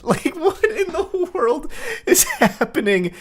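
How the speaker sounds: high, slow, laughing through the question; can't believe what you're reading; incredulous laugh; laughing in disbelief. Real, not acted. Laughing at absurd text.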